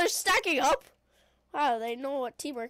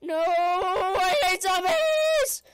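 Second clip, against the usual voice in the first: long drawn-out loud wail; high shout breaking upward; exasperated, slow cracking cry; voice-cracking yell; 4 semitones higher, 7 dB louder, much slower than usual. Real, not acted. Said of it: "But I love voice cracks".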